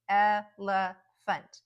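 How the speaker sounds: elephant slowly in three parts, el-e-phant; syllable by syllable. Shown as a demonstration. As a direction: break elephant into three slow, loud syllables. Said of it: "There's a three-syllable word".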